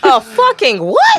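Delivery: disbelieving loud question; stunned, drawn out; shocked. Real, not acted. Shocked by puma label.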